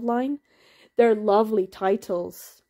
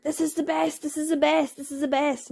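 higher, gushing fan chant; mock hyping fans; 5 semitones higher than usual. Fans hyping a manga to her, gushing. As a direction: imitate fans hyping the book. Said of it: "They were like".

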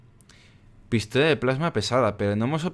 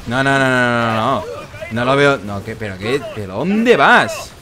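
loud, real outburst of disbelief; exasperated protest; 3 semitones higher, 6 dB louder than usual. Real, not acted. Player railing at the mission's makers, loud exasperation. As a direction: protest loudly, incredulous at the absurdity.

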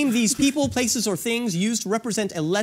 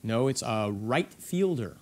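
lower, jock-style quizmaster; 9 semitones lower, 5 dB quieter than usual. Pretend. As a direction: answer like a laid-back jock. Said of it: "say it in more of a jock voice".

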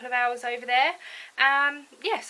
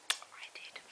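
guilty little whisper; whispered confession; 23 dB quieter than usual. Real, not acted. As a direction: whisper it like a secret. Admitting guilt aside.

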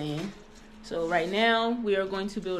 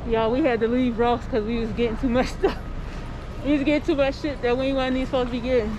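breathless, loud and puffing; out of breath; 3 semitones higher, 6 dB louder than usual. Real, not acted. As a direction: talk while catching your breath. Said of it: "I'm out of breath".

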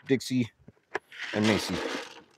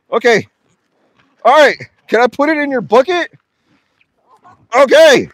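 high, loud, rattled pleading; flustered alarm; 12 semitones higher, 10 dB louder than usual. Real, not acted. Feeder asking a pushy animal for room, flustered. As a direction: try to manage the animal while nearly panicking.

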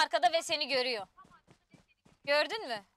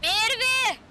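calling out; 7 semitones higher, 7 dB louder than usual. Real, not acted. Calling out to teammate.

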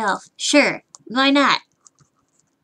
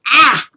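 frustrated yell; 2 semitones higher, 8 dB louder than usual. Acted. Streamer dreading another crash, frustrated yell. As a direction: let out a quick frustrated cry. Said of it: "I'm frustrated and I'm angry".

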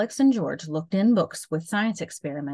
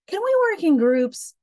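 put-on child voice; 13 semitones higher, 6 dB louder than usual. A narrator's reading. Child character asking teacher.

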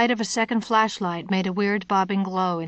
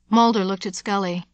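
usual speaking voice

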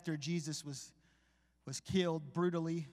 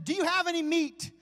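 high, loud yell across the water; yelled call from shore; 13 semitones higher, 11 dB louder than usual. Acted. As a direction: call out to the boat. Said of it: "He yells at them".

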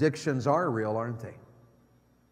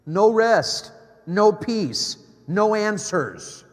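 louder, pressing list; rising emphasis; 5 semitones higher, 6 dB louder, much slower than usual. Real, not acted. Preacher listing life's frustrations to teens, forceful. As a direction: hammer each no home.

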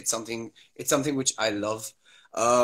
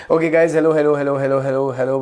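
loud greeting; 3 semitones higher, 10 dB louder, faster than usual. Real, not acted. Greeting returning viewers on live.

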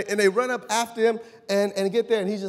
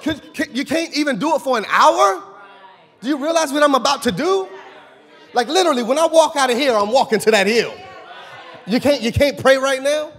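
loud, higher, pleading rebuke; frustrated shout; 5 semitones higher, 6 dB louder than usual. Acted. Jesus rebuking sleeping disciples, loud and frustrated. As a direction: shout at them in disbelief.

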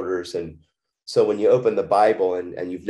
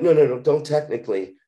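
higher-pitched, insistent objection; retold protest; 7 semitones higher, 3 dB louder than usual. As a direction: cut off the hedging answer, voice raised in pitch. Retold argument with the counselor.

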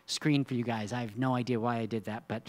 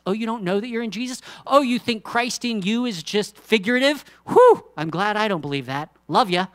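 louder, higher mock-incredulous jab; teasing jab; 9 semitones higher, 11 dB louder than usual. Preacher teasing listeners who disagree, playful mock scorn. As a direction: needle them playfully, then love them.

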